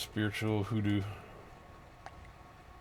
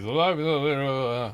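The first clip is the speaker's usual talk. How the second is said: higher, louder babbling imitation; mock foreign gibberish; 5 semitones higher, 6 dB louder than usual. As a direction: mimic a stranger's phone chatter. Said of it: "He's like".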